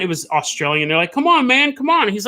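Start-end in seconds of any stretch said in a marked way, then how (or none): 1.1-2.1 high, insistent mock plea; retold urging. Movie characters hurrying a friend from zombies, retold urging. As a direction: imitate friends hurrying someone along, pitched up.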